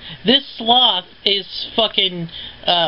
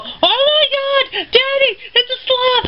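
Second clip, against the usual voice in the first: put-on scared kid. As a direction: squeal it like a frightened little kid. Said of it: "they'll go".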